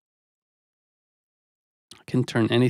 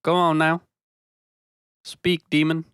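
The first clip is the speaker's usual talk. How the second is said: mock scammer grilling; 5 semitones higher than usual. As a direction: bark at the scam caller like a cartoon inquisitor.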